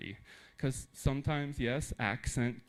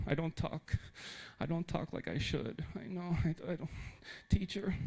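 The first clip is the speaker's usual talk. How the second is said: lightly voiced student, higher and softer, halting; mock self-doubting student; 6 semitones higher, 5 dB quieter than usual. Student apologizing to teacher about their accent, mildly imitated unsure voice. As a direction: imitate an unsure pupil, hesitant and raised in pitch.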